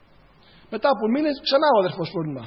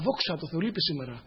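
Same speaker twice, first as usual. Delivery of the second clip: relieved mutter; 4 semitones lower, 7 dB quieter than usual. Retold or imitated. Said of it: "πιάνω μια φορά τον εαυτό μου".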